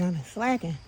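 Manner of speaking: usual speaking voice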